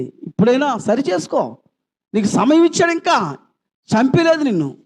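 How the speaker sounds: thundering call to repent; fiery preaching. Acted. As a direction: preach repentance with full force. Pastor exhorts the congregation.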